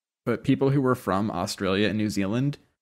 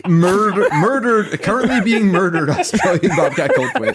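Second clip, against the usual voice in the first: laughing; 9 semitones higher, 7 dB louder than usual. Real, not acted. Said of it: "laughing loud commentary".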